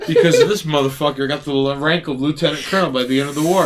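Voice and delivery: fast laughing rush. Real, not acted. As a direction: rattle it off quickly, laughing. Rushed reread with laughter.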